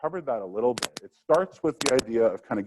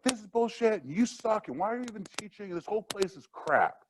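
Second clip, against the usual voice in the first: much higher mock rant; imitating angry student; 10 semitones higher than usual. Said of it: "student who's like".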